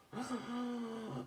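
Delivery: sharp shocked intake of breath; mock baby gasp. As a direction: gasp like a startled toddler. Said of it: "Tommy goes".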